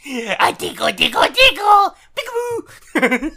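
high, loud giggling tickle voice; giggly tickle attack. Pretend. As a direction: tease like tickling a baby, giggling. Playful tickle bit.

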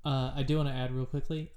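usual speaking voice

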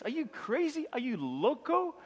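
mocking disbelief. Crowd mocking Jesus's claim, incredulous scoffing. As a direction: sneer it, incredulous. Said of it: "They replied".

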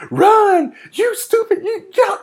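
high, frantic imitation yell; mock yelling. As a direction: yell at the screen in played-up panic. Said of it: "get the urge to yell at people".

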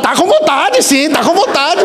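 high teasing insistence; mock insistence. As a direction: lean on him playfully until he agrees. Gangster insists he wants to.

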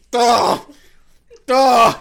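high, loud imitated "duh" gasp; two high-pitched mock-dazed blurts; dazed surfacing noise. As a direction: mimic someone bursting up from underwater, dazed. Mimics her surfacing dazed from water.

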